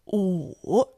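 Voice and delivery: Wu low and dipping; third tone. Shown as a demonstration. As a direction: drop Wu low and dip it. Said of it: "left with the third tone".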